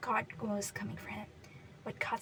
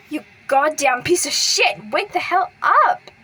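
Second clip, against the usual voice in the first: screaming, higher and louder, drawn out; furious shout; 3 semitones higher, 13 dB louder than usual. A narrator's reading. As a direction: scream at him to wake. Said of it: "He found himself shouting".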